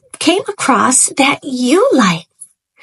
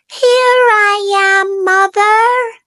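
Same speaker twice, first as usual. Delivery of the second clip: put-on calling baby bird; 9 semitones higher than usual. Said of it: "he called out".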